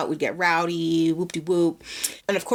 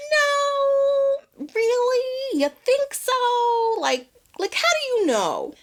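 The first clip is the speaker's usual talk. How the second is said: very high, stretched-out disbelief; exaggerated high-pitched doubting voice; doubtful friend; much slower than usual. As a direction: imitate a friend, squeaky-high and drawn out. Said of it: "my friend is just like".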